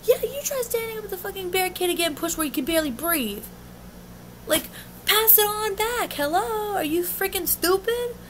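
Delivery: high-pitched, scolding retort at normal volume; retold angry comeback. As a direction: act out telling them off, pitched high. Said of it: "And I'm like".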